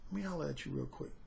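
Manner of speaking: soft, low smooth talker; low murmured pickup line, coaxing; smooth serpent. Retold or imitated. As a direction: sweet-talk her quietly like a smooth operator. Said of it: "that serpent was smooth".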